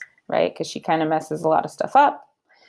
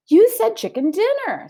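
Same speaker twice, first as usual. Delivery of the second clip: much higher, innocent maid's voice; innocent Amelia Bedelia; 13 semitones higher, 3 dB louder than usual. Acted. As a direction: explain it sweetly, sure she's right. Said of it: "said Amelia Bedelia".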